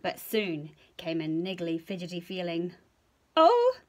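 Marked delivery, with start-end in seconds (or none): 3.4-3.8 sharp high squawk of alarm; cried out. Acted. Bird yelps at a sudden itch, loud high cry. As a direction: yelp as the itch strikes. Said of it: "cried Feather McDoo".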